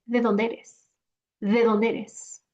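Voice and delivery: "¿De dónde eres?" fused, "dónde eres" as one word; casual native blend of the vowels; relaxed everyday question; natural conversation speed. Shown as a demonstration. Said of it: "If I were to say this in a normal conversation".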